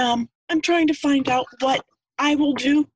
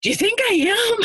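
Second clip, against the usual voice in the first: surprised question; 11 semitones higher than usual. Retold or imitated. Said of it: "And I was like".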